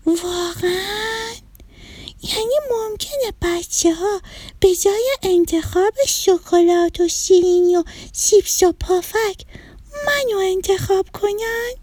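high, childlike cartoon voice; high-pitched, hopeful little-character voice; hopeful little broccoli. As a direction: ask in small, wondering disbelief.

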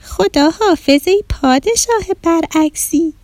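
put-on high, slow little-girl call. A narrator's reading. Girl returning the king's backwards greeting, calling out. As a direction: call out to the king in a drawn-out high voice. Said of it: "آدیشه بلند گفت".

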